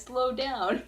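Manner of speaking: joking urge to slow down; playful plea. Real, not acted. Begging subscribers, playful.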